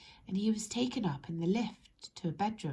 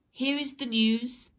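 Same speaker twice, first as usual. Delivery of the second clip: slow, louder, formal news read; news reader; 5 semitones higher, 7 dB louder than usual. Acted. TV newsreader opening the bulletin, formal and slow. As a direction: announce it like a TV newsreader. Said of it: "Instead of saying".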